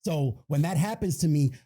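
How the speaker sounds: ordinary delivery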